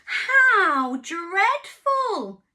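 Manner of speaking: very slow, loud, drawn-out dismay; hammed-up farmyard gasp of dismay; dismayed chorus. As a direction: stretch it out in mock horror. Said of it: "said everyone".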